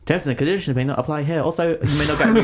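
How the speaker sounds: rattled off fast like small print; quick mock disclaimer voice; fine print read. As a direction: race through it like the legal bit at the end of an ad. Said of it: "read out the fine print".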